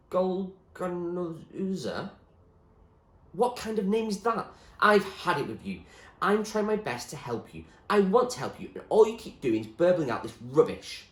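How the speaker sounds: exasperated Zoe. Acted. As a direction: snap at his nonsense. Zoe loses patience.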